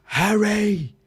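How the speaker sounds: high, loud mock Harry Potter British cry; Harry Potter British accent. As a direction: call out in exaggerated British wizard-film accent. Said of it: "replicate how they talk in Harry Potter".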